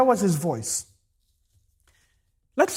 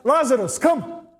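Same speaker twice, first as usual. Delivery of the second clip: high, commanding call; crying out; 5 semitones higher than usual. Retold or imitated. Preacher repeating Jesus' call to Lazarus, crying out. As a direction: summon him with one short raised call. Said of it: "and cries out".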